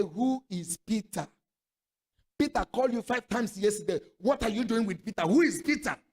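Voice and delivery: loud, accusing "Who is Peter?"; jealous husband. Retold or imitated. Suspicious husband grills wife over calls, loud accusation.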